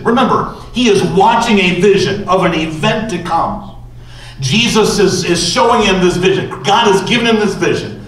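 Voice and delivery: usual speaking voice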